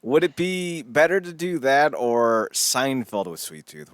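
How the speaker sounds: usual speaking voice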